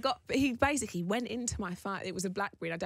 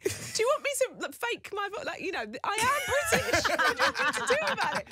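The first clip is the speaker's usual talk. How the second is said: high, laughing protest; mock exasperation; 4 dB louder than usual. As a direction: protest in comic exasperation, much higher. Mock-exasperated retort to her daughter.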